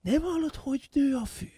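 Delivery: very high, soft marvelling; awed wonder. Acted. Son marvelling at the moving world, high and soft. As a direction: hush it in amazement.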